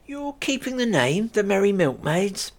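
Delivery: high, questioning; surprised and pleased; older lady voiced, curious. Lady asks Kate if they are keeping the pub name.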